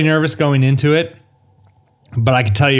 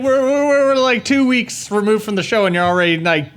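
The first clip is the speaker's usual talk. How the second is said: mock outrage; 9 semitones higher than usual.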